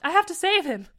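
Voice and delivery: high, loud, urgent protest; desperate plea. A narrator's reading. Liz begging the captain to let her help, desperate. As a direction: plead hard to be allowed to stay.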